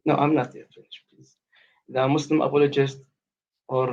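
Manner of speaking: normal talking voice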